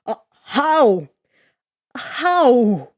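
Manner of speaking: loud astonished how; stunned disbelief. Acted. Odysseus amazed as boats speed, loud disbelief. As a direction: blurt it out in shock.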